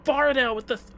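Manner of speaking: high, excited cry of thanks; thrilled shout-out. Real, not acted. Streamer thanking Bardell for three thousand, thrilled. Announcing a big donation.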